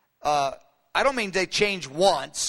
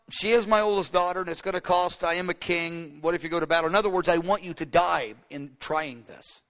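ordinary delivery

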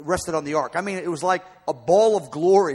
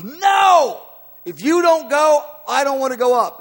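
loud, high, desperate cry of refusal; Moses protesting; 10 semitones higher, 10 dB louder than usual. Acted. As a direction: plead at the top of your voice.

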